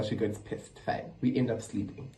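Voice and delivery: ordinary talk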